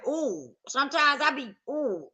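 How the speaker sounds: ordinary delivery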